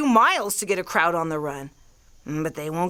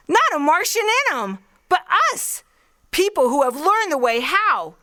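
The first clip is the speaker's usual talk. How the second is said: rising excitement; 8 semitones higher than usual. Acted. Artilleryman pictures stolen Martian machines, voice climbing with excitement. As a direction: let the dream run away with you. Carried away by his own vision.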